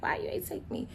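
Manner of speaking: a whispered mock kid's complaint; whiny kids. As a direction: hush it like sulky kids asking why. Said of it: "'cause they gonna be pissed off, like".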